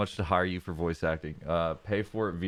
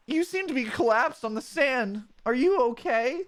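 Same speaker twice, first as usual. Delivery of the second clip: put-on raised, startled young voice; alarmed partner Mudkip; 3 dB louder than usual. Mudkip partner spotting someone collapsed on the sand, startled and raised. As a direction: gasp and hurry over in alarm.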